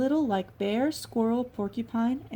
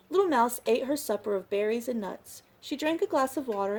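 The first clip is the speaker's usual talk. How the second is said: ordinary delivery, nothing marked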